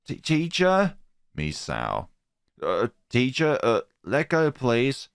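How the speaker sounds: put-on a girl pleading with her teacher to let go; nervous schoolgirl. Frightened schoolgirl.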